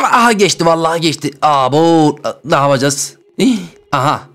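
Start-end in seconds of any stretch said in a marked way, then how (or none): none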